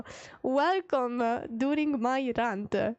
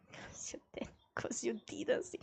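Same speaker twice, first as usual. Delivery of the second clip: whispered, almost secret; hushed confiding whisper; 2 semitones lower, 11 dB quieter than usual. Real, not acted. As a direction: lean in and whisper it. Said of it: "whispered aside".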